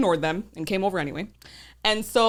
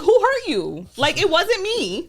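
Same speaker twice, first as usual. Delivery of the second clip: sassy high retort; 9 semitones higher, 4 dB louder than usual. Retold or imitated.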